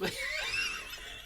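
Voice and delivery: a real high squeal of a laugh. Real, not acted. Retelling the F off reply.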